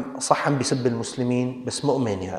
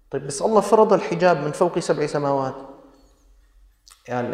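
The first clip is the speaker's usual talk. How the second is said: usual speaking voice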